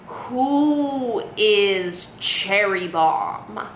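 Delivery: very drawn-out, cryptic question; riddling mom. Retold or imitated. Mom cryptically quizzing her daughter on the phone, riddling. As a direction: stretch every word like posing a riddle. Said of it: "like it's like a riddle".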